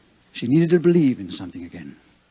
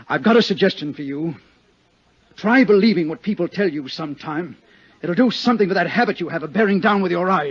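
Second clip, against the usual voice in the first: indignant retort; 7 semitones higher, 7 dB louder than usual. Acted. Scolds the detective.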